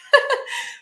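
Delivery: a burst of real high laughter at herself. Real, not acted. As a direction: laugh it off, caught out. Laughs at own Spanish.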